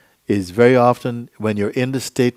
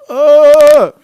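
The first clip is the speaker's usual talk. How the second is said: loud, high-pitched yell; full-voiced imitated holler; imitated shout; 16 dB louder than usual. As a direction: let out a big open "ah" shout. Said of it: "He goes".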